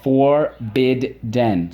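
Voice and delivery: "Forbidden" slowly chopped into FOR, BID, DEN; three evenly spaced beats on "Forbidden"; syllable by syllable. Shown as a demonstration. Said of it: "Let's go".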